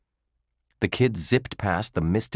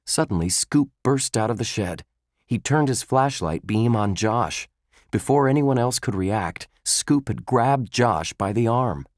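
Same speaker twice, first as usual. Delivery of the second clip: ordinary delivery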